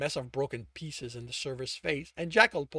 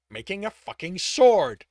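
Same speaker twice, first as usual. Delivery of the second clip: loud, higher, spitting the curse; angry retort; 5 semitones higher, 12 dB louder than usual. A narrator's reading. Tibbs answering the server, loud angry retort. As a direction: throw the answer back hard.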